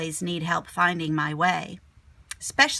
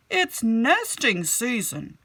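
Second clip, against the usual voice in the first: high, much louder friendly warning, slow at start; man walking by; 4 semitones higher, 5 dB louder, slower than usual. Acted. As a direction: call over kindly to the kid. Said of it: "said a man walking by".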